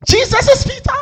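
loud high mock disbelief; incredulous high exclaim. Real, not acted. Preacher reacting loudly to a congregant's odd answer. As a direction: sound amazed at the answer.

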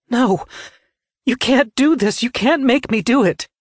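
high, distraught refusal; panicked, high-pitched outcry; desperate protest. A narrator's reading. Citra refuses to harm brother.

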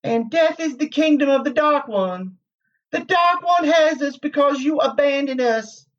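high, mournful apparition voice; sad. A narrator's reading. Egwene's apparition accusing Rand of abandonment, sad. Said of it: "she said sadly".

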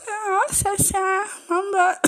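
very high, slow, sweet greeting; feminine voice. Shown as a demonstration. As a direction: coo the greeting in a girly voice. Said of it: "that feminine voice".

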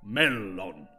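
clear, ringing spoken word; a clear, ringing password. A narrator's reading. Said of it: "said in a clear voice".

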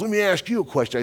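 high-pitched imitation of an irritated kid; retold mad challenge. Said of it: "And he was mad".

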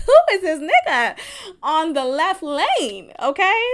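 loud, high, laughing gush; shouting and laughing over a fine stranger; excited gushing. Retold or imitated. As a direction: squeal over the cute guy in the next lane.

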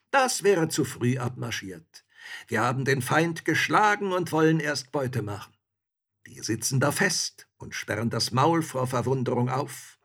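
confident soldier bragging to a comrade; triumphant soldier. A narrator's reading. Soldier figure boasts.

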